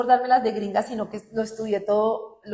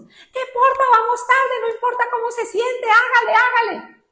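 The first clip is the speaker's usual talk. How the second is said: much higher, loud, dismissive push; bully yourself into hurrying; loud, impatient "hágale, hágale"; harsh self-talk; 14 semitones higher, 10 dB louder than usual. Retold or imitated. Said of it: "así nos tratamos a nosotros mismos".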